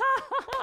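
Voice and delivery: high, giddy burst of laughter; imitated child's laugh. Daughter watches blocks collide, imitated giddy laugh. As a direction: mimic a delighted little girl cracking up. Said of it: "And she laughs".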